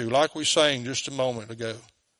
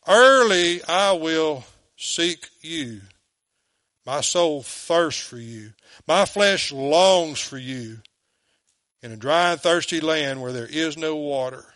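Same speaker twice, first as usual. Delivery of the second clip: slow psalm reading; slower than usual. Acted.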